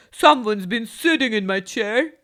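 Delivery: loud booming papa bear; loud Father Bear complaint. A narrator's reading. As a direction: boom it like a big bear. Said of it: "he said in a very loud voice".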